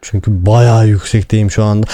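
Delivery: ordinary talk, nothing marked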